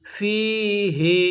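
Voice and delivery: ফিহি with its last vowel held long; টান দিয়ে ফিহি. Shown as a demonstration. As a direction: draw out ফিহি very slowly. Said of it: "এখানেও কিন্তু টানের অপশনটা আছে".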